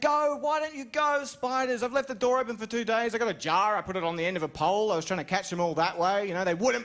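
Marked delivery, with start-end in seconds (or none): 0.0-3.1 exasperated pleading. Retold or imitated. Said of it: "I was like".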